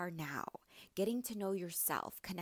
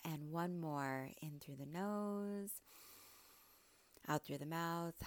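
soft, unhurried meditation voice with long pauses; hushed, soothing coach leading breathwork; guided meditation; 7 dB quieter than usual. Real, not acted. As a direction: gently guide listeners into stillness.

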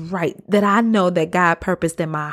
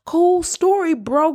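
sarcastic dismissal; 9 semitones higher than usual. Pretend. Mock dismissive reply to story.

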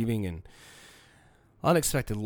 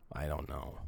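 barely audible; 8 semitones lower, 12 dB quieter than usual. Real, not acted. Repeated, sinking to near silence.

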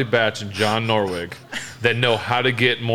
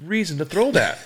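higher, slower voice in amazed disbelief; a light, pitched-up note of astonishment; mock disbelief; 6 semitones higher than usual. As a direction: marvel at an impossible throw. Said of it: "and I'm like".

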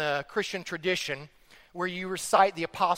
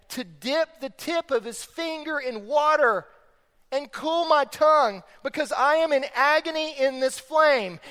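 high, insistent plea; crying out; 8 semitones higher, 5 dB louder than usual. Retold or imitated. Preacher voicing rich man begging for water, crying out. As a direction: plead from agony in a raised voice. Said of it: "he cries out to Father Abraham".

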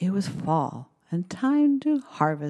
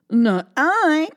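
high, loud quacking duck; 9 semitones higher, 5 dB louder than usual. Acted. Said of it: "quacked the duck".

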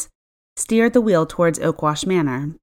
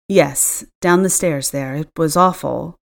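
ordinary delivery

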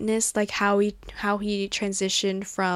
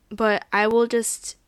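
ordinary talk

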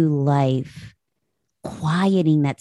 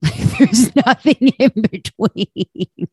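laughing through the words; 6 semitones higher, 5 dB louder, slower than usual. Real, not acted. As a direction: chuckle while saying it. Laughing at own forgetfulness.

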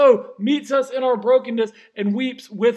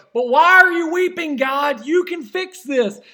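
raised, higher plea of why; pleading cry; 6 semitones higher, 6 dB louder than usual. Retold or imitated. Preacher voicing the hurting believer, loud and pleading. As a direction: cry out the question at God. Congregation's imagined plea to God.

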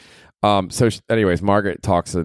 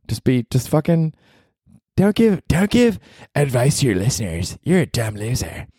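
higher, mocking heckler voice; 6 semitones higher than usual. A fake heckler insulting the host in a put-on higher voice. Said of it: "you spoke in that voice".

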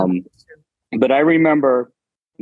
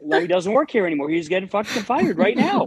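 mock panic; 10 semitones higher than usual. Panicked inner thought retold.

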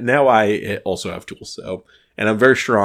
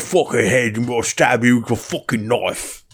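hammed-up British TV gangster accent; mock British accent; 2 semitones higher than usual. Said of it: "everyone talks like this".

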